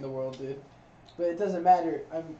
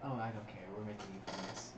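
quiet insistence; 8 semitones lower, 13 dB quieter than usual. Real, not acted.

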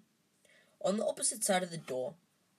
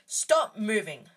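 loud, slow firm command; ordering; 3 semitones higher, 7 dB louder, slower than usual. A narrator's reading. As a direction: order the boys to stop struggling. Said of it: "Hermione ordered them".

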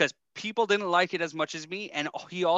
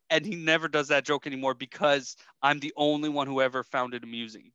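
ordinary talk

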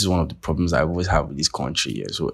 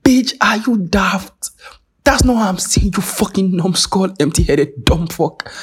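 much higher, louder and slow, spitting each insult; imagined furious retort; 14 semitones higher, 4 dB louder, slower than usual. Retold or imitated. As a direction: let the scorn explode in a high, drawn-out shriek. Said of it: "I'm like".

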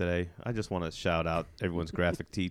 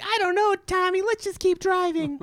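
imitating the girlfriend; 4 dB louder than usual. Podcaster retelling the movie parodies the girlfriend in a much higher voice. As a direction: squeak it out like a clueless movie girlfriend.

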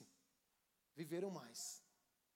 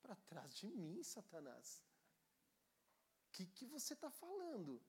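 calm rebuke; 8 dB quieter than usual. Retold or imitated. Jesus calmly rebuking Pedro. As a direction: rebuke him quietly. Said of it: "com muita tranquilidade".

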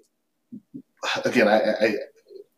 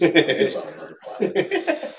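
laughing through the words, slowly; grinning, can barely keep it in; 10 semitones higher, 3 dB louder than usual. Real, not acted. Telling about the drunk guy.

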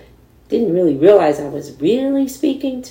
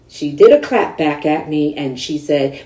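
usual speaking voice

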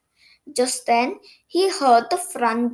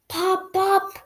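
popping engine sound; car popping noise; 7 semitones higher than usual. A narrator's reading. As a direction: pop it like a backfiring car.

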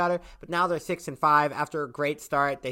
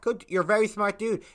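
usual speaking voice

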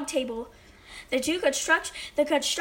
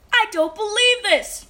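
loud, high disbelief; astonished exclamation; 6 semitones higher, 5 dB louder than usual. A narrator's reading. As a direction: exclaim as a flabbergasted judge. Judge astonished by boy's eating.

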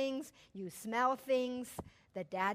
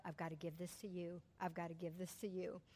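hushed prayer; 6 semitones lower, 13 dB quieter than usual. Retold or imitated. Praying to God, letting go.

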